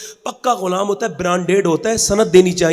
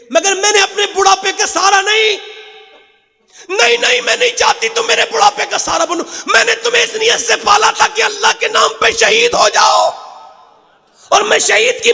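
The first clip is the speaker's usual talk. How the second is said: fervent preaching; 11 semitones higher than usual. Acted. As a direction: cry out the mother's vow to the crowd. Mother's fervent declaration, preached.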